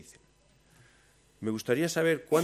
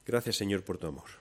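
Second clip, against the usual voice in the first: prayer. Acted. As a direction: speak humbly to God. Leads a closing prayer.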